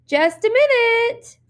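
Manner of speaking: put-on high, slow little girl. A narrator's reading. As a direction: voice a small girl stalling for time. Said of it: "the little girl said".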